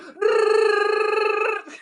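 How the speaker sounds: long high-pitched trill; high trilling noise of frantic wriggling; imitated swarming noise. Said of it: "It was like".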